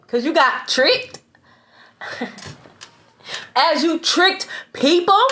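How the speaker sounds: slow, laughing taunt; amused gloating; mocking laugh. Real, not acted. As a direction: chuckle through the words at them. Mocking those who got tricked.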